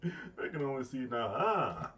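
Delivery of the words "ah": a mock British accent, higher, louder and fast; a hammed-up British accent, higher and louder, with a laugh at the end. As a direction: put on a cheeky British accent to tease the Brits. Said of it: "that wasn't even my best British accent".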